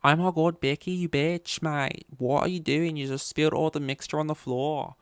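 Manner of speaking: high-pitched Australian soap drama bitchiness; Australian drama girl snapping at Becky; Australian soap accent. Acted. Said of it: "two friends are arguing and they'll be like".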